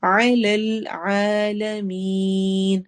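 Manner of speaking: علی العالمین with both ع from mid throat and a long final مین; throaty ain. Shown as a demonstration. Teacher reciting علی العالمین, slow. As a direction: sound both ع from the throat, stretch the end. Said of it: "عین ... حروف حلقی میں سے".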